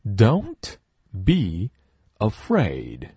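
ordinary talk, nothing marked

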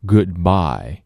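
"Goodbye" with the d almost gone, gliding into b; light d in goodbye. Shown as a demonstration. Teacher demonstrating the dropped d in "Goodbye". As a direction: swallow the d of "Goodbye". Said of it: "的变得非常轻".

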